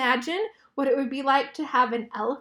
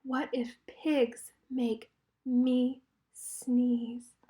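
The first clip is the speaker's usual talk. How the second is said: slow worried question; 5 dB quieter, much slower than usual. A narrator's reading. Gerald fearful realization.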